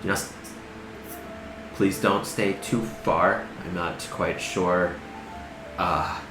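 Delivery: quiet, slow, hesitant NPC. Acted. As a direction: speak as a wary, soft-spoken man. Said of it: "and he says".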